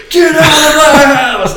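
high, very loud, drawn-out shriek; full-volume mock night-terror yell; mimicked scream. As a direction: burst in with a hammed-up scream. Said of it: "He busts through the door and goes".

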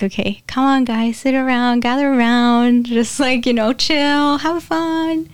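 relaxed Jesus. Retold or imitated. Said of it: "So he's like".

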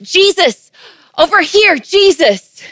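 loud, high acted shout; desperate father hollering, pitched high and loud; crying out. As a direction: call out over a noisy crowd for attention. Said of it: "he cries and shouts".

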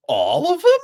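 high, loud, hammed-up astonishment; ridiculous mock-shocked shout; over-the-top comical. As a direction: ham up the disbelief like a cartoon. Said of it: "really ridiculous, over the top sort of, like, comical way".

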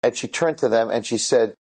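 ordinary delivery

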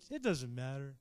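low, settled reassurance; at peace. Acted. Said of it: "all of a sudden you got peace".

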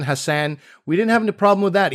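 ordinary delivery

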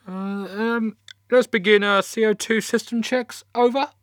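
high nervous voice over comms; rushed, awkward controller covering up; flustered radio controller. Acted.

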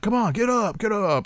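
high-pitched urging of a fallen fighter; mock cheer, pitched well up; imitated cheering on. Podcast host acts out fighters rallying their fallen friend, cheering on. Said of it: "cheer on Art Lean".

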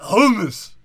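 loud, high HUMMUS choked out after throaty retching; gag, then force out "hummus"; gagging throat sounds. Shown as a demonstration. Demonstrating a word as if swallowing, gagged loud "hummus". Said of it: "like you just swallowed cum".

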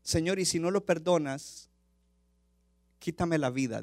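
a soft, broken plea to God; tearful prayer. Acted. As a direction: Moses weeping before the Lord, hushed and pleading. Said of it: "llorando delante del Señor".

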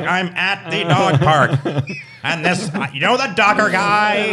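slow, droning Squidward drawl; Squidward impression. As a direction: imitate Squidward, bored and slow. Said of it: "remind me so much of Squidward".

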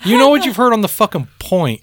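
high-pitched incredulous complaint; retelling indignant inner rant; retold exasperation. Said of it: "And I'm like".

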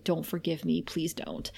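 low, very soft retold plea; hushed imitation of someone begging through sobs; understated sobbing plea. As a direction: plead quietly, sinking almost to nothing. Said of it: "And she starts to sob".

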